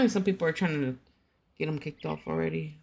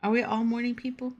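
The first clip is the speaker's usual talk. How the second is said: normal talking voice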